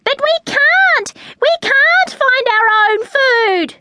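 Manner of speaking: very high, loud chick's protest; shriek. A narrator's reading. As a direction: squeal in protest at being refused. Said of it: "shrieked Lara".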